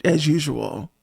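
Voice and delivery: ordinary talk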